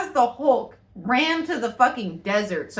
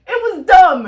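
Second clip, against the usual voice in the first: louder and higher through laughter; laughing shout; 8 semitones higher, 12 dB louder than usual. Real, not acted. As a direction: yell it again while laughing. Repeated laughing verdict.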